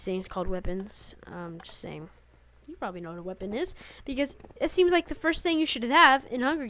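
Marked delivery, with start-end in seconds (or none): 1.3-3.5 whispering. Real, not acted.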